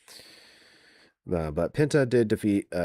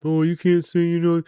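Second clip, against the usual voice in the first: high, loud mocking whine; mocking whiny voice; 10 semitones higher, 7 dB louder than usual. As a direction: sneer it like a whiny critic. Mocking critics' whiny objection.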